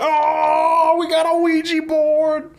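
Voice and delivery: high, loud excited yelling; excited shout. Retold or imitated. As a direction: boys shouting with thrilled glee. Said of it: "they all are excited".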